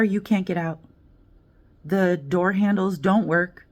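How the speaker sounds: ordinary talk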